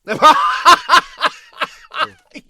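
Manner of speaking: big high burst of laughter; real laugh at the remote-control joke; loud laugh. Real, not acted. Host laughs hard at co-host being the remote.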